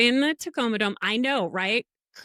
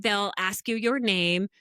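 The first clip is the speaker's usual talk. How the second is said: normal talking voice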